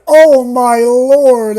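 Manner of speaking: loud, high, drawn-out exclamation; hammed-up jump-scare shock; mock terror. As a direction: wail it in exaggerated fright.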